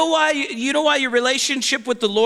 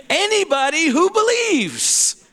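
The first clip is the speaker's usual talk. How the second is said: higher repeat; 6 semitones higher than usual. Shown as a demonstration.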